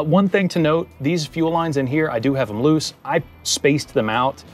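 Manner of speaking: ordinary talk, nothing marked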